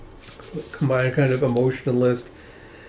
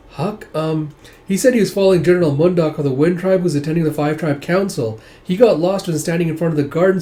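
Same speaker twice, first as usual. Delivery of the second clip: put-on higher, louder gushing child; excited little boy; 5 semitones higher, 7 dB louder than usual. Young son tells mother of new friend, excited. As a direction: rattle on in boyish admiration.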